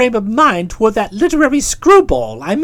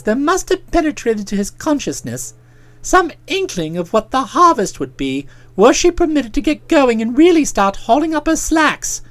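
ordinary delivery, nothing marked

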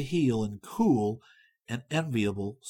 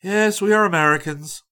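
plain reading voice

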